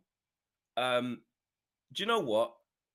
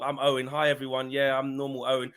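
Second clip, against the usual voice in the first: mocking Owen; 3 semitones higher, 5 dB louder than usual. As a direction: mimic his plain chat then drop into the accent. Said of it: "you're doing the casual, normal, normal talk".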